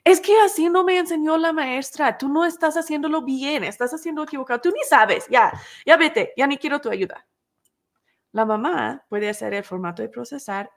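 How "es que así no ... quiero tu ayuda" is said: put-on higher, louder frustrated child's outburst; daughter yelling. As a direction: snap back at mom like a fed-up kid. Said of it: "empieza a gritarle a su mamá y dice".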